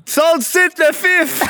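high, loud shout from afar; re-enacted insult, hollered high; imitated heckling yell. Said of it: "il s'est fait crier".

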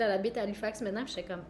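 usual speaking voice

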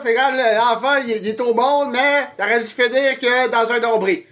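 lightly voiced pompous doctor; Doc Mayou voice. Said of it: "Docteur Mayou". (voix de docteur qui explique, un peu aiguë)

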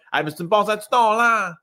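high-pitched Saguenay whine; plaintive Saguenay accent. Retold or imitated. Lady asking for the milk, plaintive Saguenay accent. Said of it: "tellement comme plaignant".

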